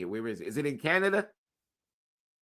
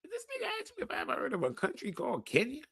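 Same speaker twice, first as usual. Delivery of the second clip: offended disbelief; 14 semitones higher, faster than usual. Pretend.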